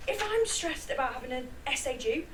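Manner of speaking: normal talking voice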